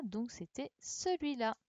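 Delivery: usual speaking voice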